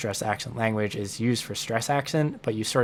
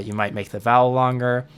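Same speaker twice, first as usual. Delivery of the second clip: ordinary talk, nothing marked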